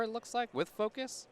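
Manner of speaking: ordinary delivery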